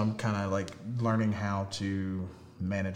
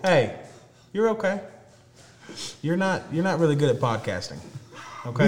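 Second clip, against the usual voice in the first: talking to a dog; 7 semitones higher, 6 dB louder than usual. Real, not acted. Talking to the dog mid-podcast.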